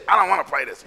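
mock-child dismissive no; sulky kid refusal. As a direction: turn it down.

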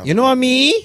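high, loud pretend challenge; hammed-up indignant question; mock confrontation. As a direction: square up in play at the counter. Acting out rude food-shop exchange.

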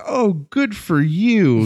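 high, drawn-out, patronizing coo; condescending, stretched-out mock praise. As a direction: slowly humour them with mock praise. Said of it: "he very condescendingly looks at her and says".

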